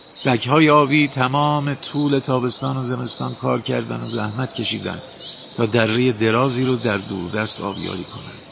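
usual speaking voice

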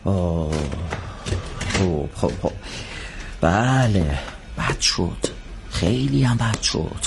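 low, soft dejected mumble; low glum self-talk. Acted. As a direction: sigh over the ruined chance. Alone, dejected after failure.

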